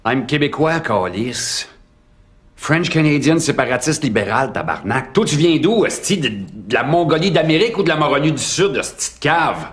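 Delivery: fast, hammed-up Québécois French outburst; a heavy Québécois accent in a rush; Québécois rant. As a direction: play a cop swearing in Québécois French. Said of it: "Okay, that was not French".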